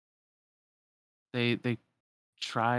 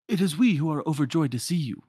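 lightly attempted French accent, higher; Lumiere; 5 semitones higher, 4 dB louder than usual. Candlestick greeting rescuers, in a light French accent. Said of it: "talking to Lumiere".

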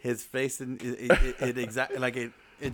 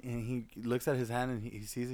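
usual speaking voice

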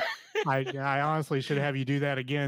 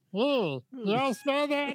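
nosy bystander; 9 semitones higher, much slower than usual. Retold or imitated. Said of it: "and then people be".

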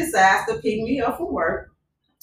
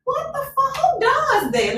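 outraged disbelief; slower than usual. Real, not acted. Outraged at the proposal.

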